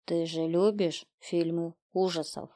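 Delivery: softly voiced, lightly menacing killer; taunting killer. A narrator's reading. Killer to the girl through the speakers, quiet taunt.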